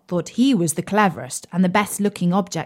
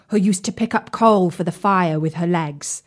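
plain reading voice

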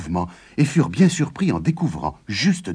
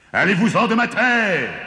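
piercing, high-pitched giant's yell; strident yell; 12 semitones higher than usual. A narrator's reading. Giant orders the couple off his land, yelling stridently. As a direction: drive them off in a shrill holler. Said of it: "hurla-t-il d'une voix stridente".

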